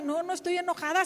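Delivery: ordinary talk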